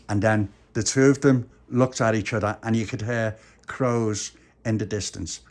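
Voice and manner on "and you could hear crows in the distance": plain reading voice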